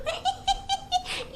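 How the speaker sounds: put-on high girlish giggle. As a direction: giggle to yourself, flattered. Vain laugh alone. (ضحكة رفيعة)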